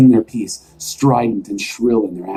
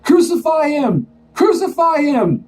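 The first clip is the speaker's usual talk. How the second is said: high, chanting mob yell, slower on the second; bay for blood like a mob; mob shouting. Acted. Crowd shouting Pilate down, high chanting yell. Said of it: "they kept shouting back".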